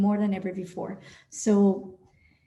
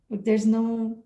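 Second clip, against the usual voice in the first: ordinary talk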